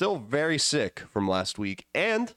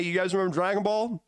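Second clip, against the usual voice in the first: ordinary talk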